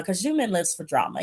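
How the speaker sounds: usual speaking voice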